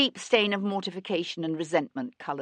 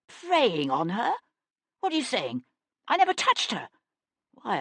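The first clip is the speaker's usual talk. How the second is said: panicked denial. Acted. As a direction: deny it in rising panic.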